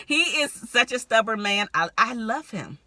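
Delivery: ordinary talk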